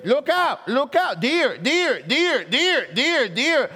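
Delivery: usual speaking voice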